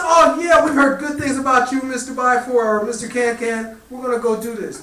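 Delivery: high, loud, gushing hen; eager hen. A narrator's reading. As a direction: gush your welcome like a flattered hen. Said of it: "She said".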